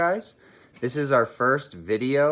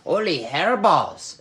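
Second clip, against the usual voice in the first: slow, loud, higher cartoon cry; excited exclamation; 5 semitones higher, 5 dB louder, much slower than usual. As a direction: shout it in comic amazement. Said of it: "exclaimed Skippy John Jones".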